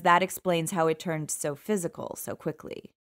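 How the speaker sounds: ordinary delivery, nothing marked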